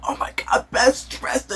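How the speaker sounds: whispered mock awe; mock gushing. Everyone fawning over another poofy dress, mocking whisper. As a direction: gush it in a breathless fake whisper. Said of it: "and everyone's like".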